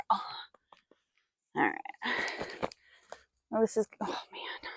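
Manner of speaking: unvoiced hushed aside; whisper. Real, not acted. Rider whispering to herself beside the horse. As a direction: whisper it so the horse stays calm.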